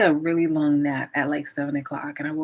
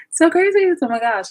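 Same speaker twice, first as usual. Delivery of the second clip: sudden high gasp, then a loud high cry of surprise; 10 semitones higher, 7 dB louder than usual. Real, not acted. Vlogger shocked at the dark outside, gasping loudly.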